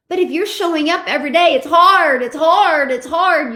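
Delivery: loud, pounding repetition, fading each time; emphatic shout. Real, not acted. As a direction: hammer it home, loudest first then easing off. Coach stressing daily struggle.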